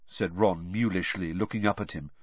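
ordinary delivery

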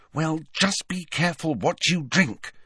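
high-pitched, mildly grim warning; a touch of grimness, pitched high. A narrator's reading. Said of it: "said Hermione grimly".

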